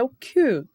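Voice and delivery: "Q" punched as the strong syllable; stressed syllable. Shown as a demonstration. Teacher naming the strongest syllable, stressed Q. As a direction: make "Q" stand out firmly. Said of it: "a sílaba mais forte é".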